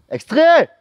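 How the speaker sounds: sudden high loud yell; shout. Real, not acted. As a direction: bark it out loud. Yelled cut-in. (cri soudain)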